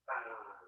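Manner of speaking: low, quiet drawn-out "uh"; stumped "uh". Acted. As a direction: trail a dim, low hesitation noise. Zeus stumped by the question.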